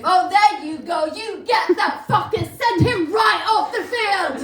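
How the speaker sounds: high, very loud cheering bellow; sports-fan yelling. Acted. Fan breaking off an apology to yell at the game, loud bellow. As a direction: bellow at the players, full throttle.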